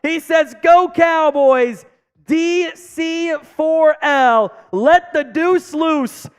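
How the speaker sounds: loud, slow, drawn-out hype call; hyped shout. Acted. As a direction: belt it out like a stadium announcer. Hyped super chat read-out.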